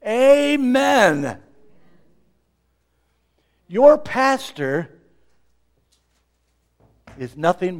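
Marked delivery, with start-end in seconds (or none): 0.0-1.4 high, loud, drawn-out amen; rousing amen. Real, not acted. Preacher's exclamation. 3.7-5.0 slow, loud, high "Your pastor"; emphatic preaching. Real, not acted.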